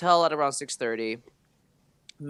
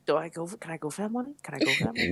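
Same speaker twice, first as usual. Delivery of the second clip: hushed, furtive asking; tentative, lowered-volume mock scheming; sneaky mock hesitation; 4 semitones higher, 6 dB quieter, much faster than usual. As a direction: ask softly, as if hoping nobody notices. Acts out eyeing dropped drunk's money.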